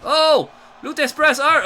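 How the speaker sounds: high, loud hype call; excited shout. Real, not acted. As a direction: shout the move out. Big move called.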